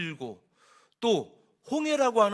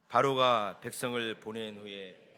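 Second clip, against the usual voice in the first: scripture reading; 8 semitones lower, 3 dB quieter than usual. Acted. Pastor leads congregation reading the verse together, solemn scripture reading. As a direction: read the verse aloud, low and unhurried. Reads scripture aloud in unison.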